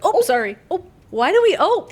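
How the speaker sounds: high, loud little blurts of apology; startled Midwestern "ope". Acted. Bumping into somebody in the grocery store, startled blurt. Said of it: "I'm always like".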